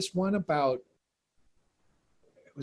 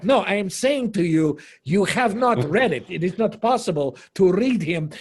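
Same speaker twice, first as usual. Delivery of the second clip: louder, higher, emphatic imitated scolding; angry Russian actress; 5 semitones higher, 7 dB louder than usual. Russian actress insisting Platonov cannot be read in translation, angry. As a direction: lay down her verdict insistently. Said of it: "She got angry at me".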